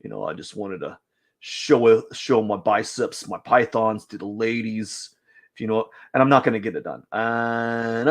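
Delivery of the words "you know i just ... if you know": low, soft bragging gym bro; macho gym guy. Acted. Voicing the bicep-pose model bragging to the ladies, low and smug. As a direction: murmur it like a smug guy showing off. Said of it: "He's like".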